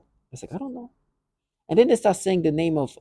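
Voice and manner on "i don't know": confused reply. Retold or imitated. Own reply, high and soft.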